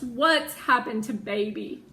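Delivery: ordinary delivery, nothing marked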